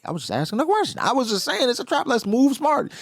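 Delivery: high, put-upon pleading voice; high defensive whine. Retold or imitated. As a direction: whine that you only asked. Struson defending himself.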